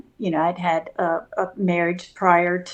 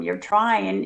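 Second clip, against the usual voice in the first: ordinary talk, nothing marked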